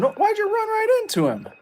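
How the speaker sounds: sudden very high panicked pitch; alarmed squeal. Real, not acted. Gamer yelping at an enemy he ran into. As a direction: yelp it out as the guy closes in.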